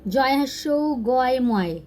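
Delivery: letter-by-letter reading. Shown as a demonstration. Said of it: "देखिए जहाँ भी".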